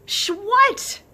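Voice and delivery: high, loud, oblivious question; loud as ever. Acted. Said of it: "her voice still as loud as ever".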